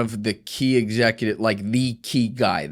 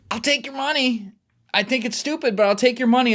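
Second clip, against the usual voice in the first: mock high-pitched voice; 13 semitones higher than usual. Fixer mocking the clients' insistence.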